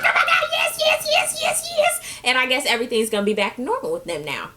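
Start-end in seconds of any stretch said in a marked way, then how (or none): 0.0-2.0 very high squealed yeses; mock excited squeal. Girlfriend accepting matching rings, squealing high. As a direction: squeal with delight.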